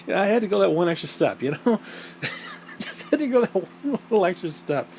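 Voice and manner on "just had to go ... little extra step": talking through a laugh. Real, not acted. Host owning up to a crude joke, laughing. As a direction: let your own joke crack you up as you speak.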